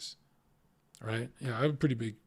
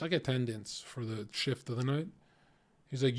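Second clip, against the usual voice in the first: ordinary delivery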